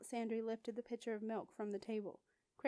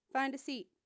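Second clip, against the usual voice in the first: curt command, higher and louder; sharp order; 4 semitones higher, 5 dB louder than usual. A narrator's reading. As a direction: bark it at the girl to end the fuss. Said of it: "her voice sharp".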